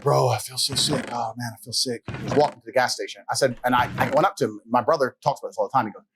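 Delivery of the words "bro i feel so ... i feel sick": a mild mock groan of a queasy drunk friend; lightly voiced sick buddy, quieter. Mate at the gas station moaning that he feels sick, softly mock-groaning. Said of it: "One of the guys was like".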